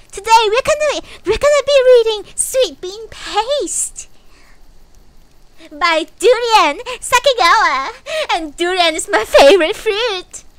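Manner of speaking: very high, loud and sugary; cutesy VTuber voice. Pretend. Vtuber pretends to introduce a book to chat, high and sugary. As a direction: announce the book like a bubbly kids' host. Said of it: "contain that voice within me".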